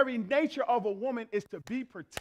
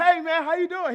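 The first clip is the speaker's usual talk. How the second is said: friendly greeting; 7 semitones higher, 8 dB louder than usual. Retold or imitated. Greeting stranger in store.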